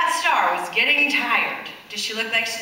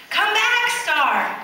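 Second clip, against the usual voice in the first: calling out; 10 semitones higher, 4 dB louder, much slower than usual. A narrator's reading. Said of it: "Davy called".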